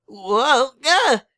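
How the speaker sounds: high loud startled cry; falling yelp. Acted. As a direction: yelp as you lose footing. Slipping and falling.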